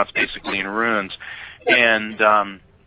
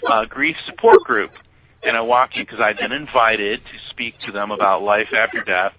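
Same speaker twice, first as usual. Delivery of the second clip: usual speaking voice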